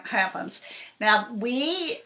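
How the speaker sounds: ordinary delivery, nothing marked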